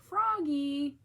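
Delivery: loud calling out. A narrator's reading. Mom calling Froggy out the door, loud call. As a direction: call after him as he runs. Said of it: "called Mom".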